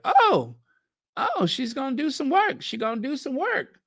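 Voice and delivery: high-pitched imitation of excited fans; mock excitement. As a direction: mimic people getting far too excited, voice way up. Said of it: "people were getting too excited".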